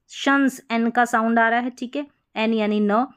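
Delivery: ordinary talk, nothing marked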